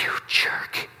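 a half-joking muttered jab; mock whispered insult. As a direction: whisper it with playful indignation. Preacher's mock reaction to Jesus.

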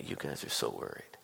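very soft, low murmur through a smile; imagined gentle aside, barely voiced; smiling Jesus. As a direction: say it almost whispered, quietly amused. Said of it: "just sitting there smiling".

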